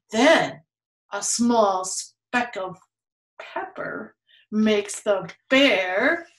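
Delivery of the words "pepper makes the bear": ordinary delivery, nothing marked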